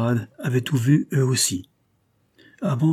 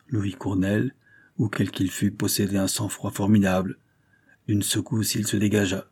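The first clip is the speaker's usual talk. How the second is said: usual speaking voice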